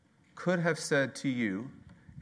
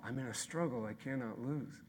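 soft, hushed conviction; softly spoken promise; quiet refrain; 9 dB quieter than usual. Real, not acted. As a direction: drop way down and say it gently.

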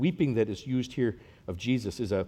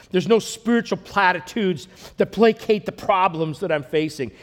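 rising, loud, higher preaching build; preaching at full force; 7 semitones higher, 8 dB louder than usual. Acted. Builds to loud, high preaching, slowing down. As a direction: pastor to congregation, preaching louder and higher.

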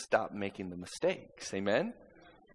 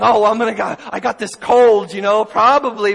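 mock worry; 9 semitones higher, 17 dB louder than usual. Mocking fearful thinking.

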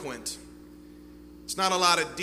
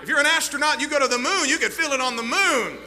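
loud, high, fast preaching crescendo; full-force preaching; 7 semitones higher, 7 dB louder, faster than usual. Acted. Preacher tells the congregation God is everywhere, full-force preaching. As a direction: preach it out, driving to the rafters.

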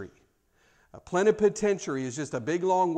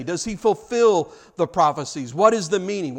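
usual speaking voice

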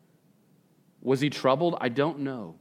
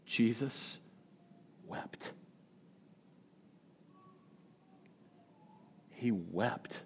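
hushed reverence; 13 dB quieter, much slower than usual. Real, not acted. Preacher dwelling on Jesus weeping.

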